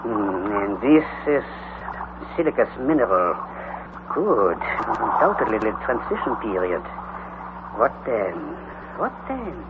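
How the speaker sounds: low, soft muttering to himself. Acted. Uncle studying the rock walls, muttering low. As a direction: murmur it under your breath, lost in thought. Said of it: "muttered again".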